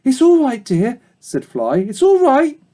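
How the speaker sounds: high, gentle reassuring voice; calm the frightened pup tenderly; Fly comforting Babe after a nightmare; soothing mother dog. Acted.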